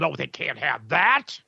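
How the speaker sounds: low, slow mock voice of the censors; mocking sneer.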